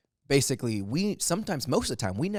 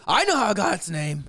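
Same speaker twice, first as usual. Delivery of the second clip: put-on higher, louder drunk-guest voice; imitated eager interjection; 7 semitones higher, 5 dB louder than usual. Drunk guest answering the dinner question, imitated eagerness. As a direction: butt in proudly, as imitation.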